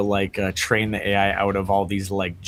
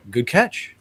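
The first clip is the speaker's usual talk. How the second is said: mock chirpy, a bit higher; breezy AI person; 6 semitones higher than usual. AI-as-person caught lying, mock chirpy. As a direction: cheerfully concede without a care. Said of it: "if A I was a real person".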